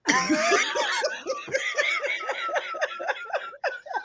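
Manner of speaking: very high, long squealing laugh; uncontrollable laughing fit. Real, not acted. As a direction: laugh helplessly until it turns to a squeal. Needs timeout from laughing fit.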